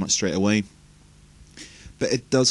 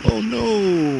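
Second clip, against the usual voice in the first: mock dismay; 11 semitones higher than usual. Mock dismay at game intro.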